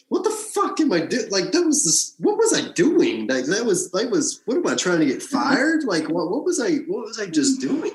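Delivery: high, stunned, asking himself what he just did; a rush of baffled self-questioning; stunned disbelief. Retold or imitated. Stand-in replaying the scene to himself, in high stunned disbelief.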